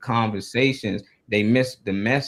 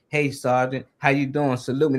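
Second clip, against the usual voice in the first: usual speaking voice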